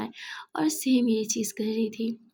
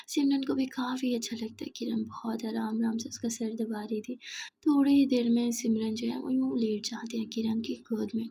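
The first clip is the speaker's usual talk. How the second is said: ordinary delivery, nothing marked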